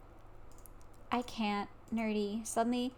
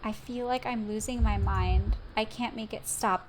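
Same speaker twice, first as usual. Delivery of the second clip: normal talking voice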